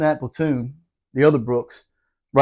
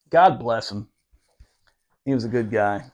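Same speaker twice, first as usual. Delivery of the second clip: ordinary talk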